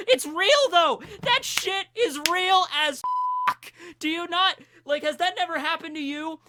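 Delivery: loud, high-pitched, emphatic insistence; impassioned outburst. Real, not acted. Vlogger insists to viewers the feeling is real, impassioned outburst. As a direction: burst out at the audience, hammering each phrase.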